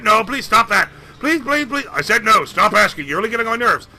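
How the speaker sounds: losing patience; irritated banker. Acted. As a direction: banker fed up with the pestering.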